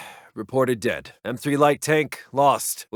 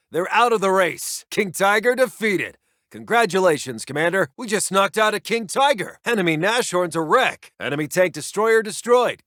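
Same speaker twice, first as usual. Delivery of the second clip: ordinary delivery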